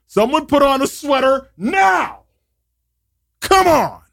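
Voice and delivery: high exasperated shout. Acted. Host pleads with listeners to wear sweaters, shouting.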